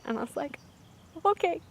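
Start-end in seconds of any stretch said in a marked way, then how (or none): 1.2-1.6 high, mock-nervous little reply; self-mocking nervous squeak; retold nervousness. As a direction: squeak out a hesitant agreement. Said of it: "I was so nervous".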